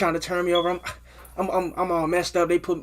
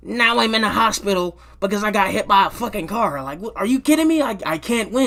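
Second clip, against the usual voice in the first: louder, higher disbelief at his luck; exasperated rant; 6 semitones higher, 6 dB louder than usual. Retold or imitated. Complaining about getting hit by a car, loud and exasperated. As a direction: vent at the universe, fed up.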